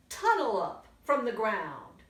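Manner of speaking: ordinary delivery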